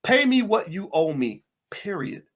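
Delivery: normal talking voice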